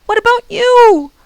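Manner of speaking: high, loud worried cry; horrified cry. A narrator's reading. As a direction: cry out for him in fear. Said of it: "Ben cried, horrified".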